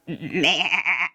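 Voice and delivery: low, long wavering bleat; quavering imitated goat noise. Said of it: "Goat goes".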